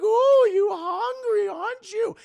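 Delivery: very high, loud, drawn-out coo; exaggerated falsetto mothering; mock baby talk. Mother offers to feed her baby, in mock high baby talk.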